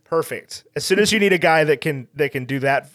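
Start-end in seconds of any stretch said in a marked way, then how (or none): none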